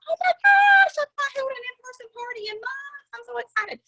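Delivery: squealing high and loud, bursting with joy; thrilled high-pitched squeal; retold excitement. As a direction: gush like you just got the best news. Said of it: "And I was like".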